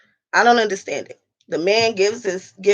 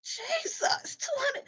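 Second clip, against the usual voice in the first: shocked cry; 15 dB quieter, slower than usual. Real, not acted. Shocked at the hair price.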